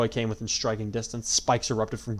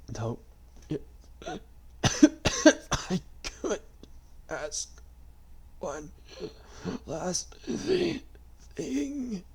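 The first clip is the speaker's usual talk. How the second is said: faint whisper; 9 semitones higher, much slower than usual. A narrator's reading. Dying man coughing.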